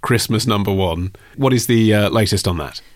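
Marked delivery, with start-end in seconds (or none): none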